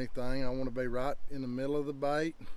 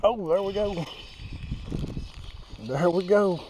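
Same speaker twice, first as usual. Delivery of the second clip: excited catch; 6 semitones higher, 3 dB louder than usual. Real, not acted. Fish hooked, angler exclaims.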